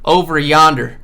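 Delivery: loud mock Southern twang; Southern accent. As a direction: holler it like a country boy pointing down the road. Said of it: "They all talk like that".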